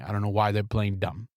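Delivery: ordinary talk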